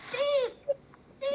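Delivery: high urgent squawking; crow squawk alarm. Acted.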